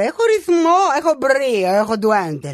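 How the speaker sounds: ordinary talk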